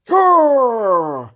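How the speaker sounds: high, loud mock battle cry; a hammed-up furious yell; angry wizard roar. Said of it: "Yes, I'm very angry now".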